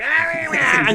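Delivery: high, loud mock screech; horrible voice. Shown as a demonstration. Man imitating a girl whispering in your ear, horrible screech. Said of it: "with a horrible voice".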